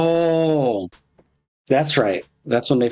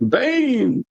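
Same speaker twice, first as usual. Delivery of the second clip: Bane voice; 11 semitones higher than usual. Retold or imitated. Imitating Bane from the movie.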